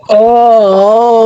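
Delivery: high, sustained groan; drawn-out wordless moan; long drawn-out "ah". Real, not acted. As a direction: hold one long high "aah". Reacting to play in the game.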